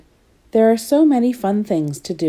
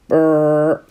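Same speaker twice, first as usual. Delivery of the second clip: machine whirr; 3 semitones lower, 3 dB louder than usual. Acted. Candy floss machine switched on.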